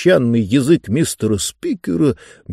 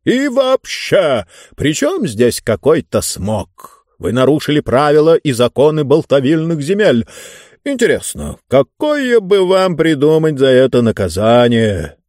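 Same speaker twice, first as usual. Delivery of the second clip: imperious ruler pronouncing judgement; pompous ruler; 4 semitones higher, 3 dB louder than usual. Acted. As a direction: relish deciding on a punishment.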